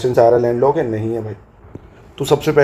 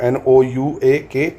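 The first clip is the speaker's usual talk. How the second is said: slow letter-by-letter spelling; dictating the spelling slowly to students; slower than usual. Shown as a demonstration. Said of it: "नौका चोट बड़ा करके दिखा दे रहा हूँ".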